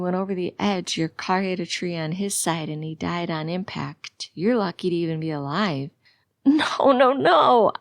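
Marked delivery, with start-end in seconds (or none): 6.4-7.7 loud, high cry of denial. A narrator's reading. Patient told that the driver died, crying out. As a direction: cry it out louder and higher. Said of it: "I cry out".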